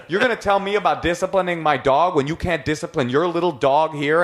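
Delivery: high, worked-up comeback; retold indignant retort. Dog owner arguing back while pointing at boyfriend, retold indignation. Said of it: "I start saying".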